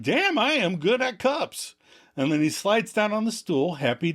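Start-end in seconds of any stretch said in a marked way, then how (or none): 0.0-1.7 smiling pride. Retold or imitated. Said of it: "Joey smiles. He's like".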